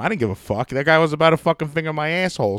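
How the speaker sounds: ordinary talk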